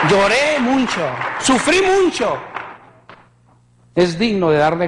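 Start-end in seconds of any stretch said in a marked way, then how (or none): 0.1-2.4 emoción al testificar. Real, not acted. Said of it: "testimonio emotivo".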